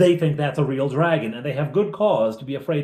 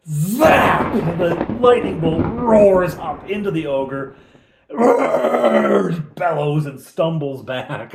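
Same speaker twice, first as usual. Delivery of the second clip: louder, high, slow epic narration; dramatic narration; 4 semitones higher, 6 dB louder, slower than usual. A narrator's reading. GM narrating the lightning bolt hitting the ogre, loud and epic. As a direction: sell the spell like an action scene.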